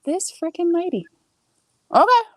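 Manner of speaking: high-pitched, incredulous grumble; mock-outraged reaction; retold exasperation. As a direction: voice shoots up, ending on a loud okay.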